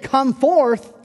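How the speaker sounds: high, loud commanding call; Jesus summoning a dead man from the grave. Retold or imitated. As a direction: call it out across the cemetery. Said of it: "he called out Lazarus".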